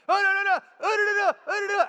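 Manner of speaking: high, loud mock tirade; in-his-face ranting. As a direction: rattle off protests in an angry man's face. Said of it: "the other guy is in his face like this".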